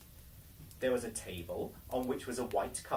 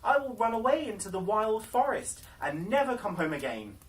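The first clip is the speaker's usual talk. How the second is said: pleading through tears; 7 semitones higher, 6 dB louder than usual. Acted. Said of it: "she began to weep and said".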